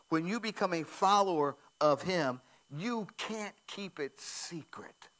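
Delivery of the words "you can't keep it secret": slow, hushed whisper. Acted. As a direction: lean in and whisper it like a secret. Preacher drops to a whisper.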